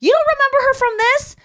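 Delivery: very high, loud pestering question; mock disbelief. Fan showing a friend a picture of her, mock disbelief. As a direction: push it way up high, as a hammed-up appeal.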